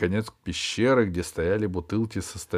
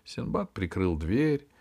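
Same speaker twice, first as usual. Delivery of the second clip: ordinary delivery, nothing marked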